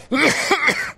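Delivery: sudden sharp cough from choking on food. Real, not acted. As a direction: cough hard, like something went down wrong. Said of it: "I'm choking on my snack".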